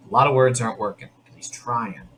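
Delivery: ordinary delivery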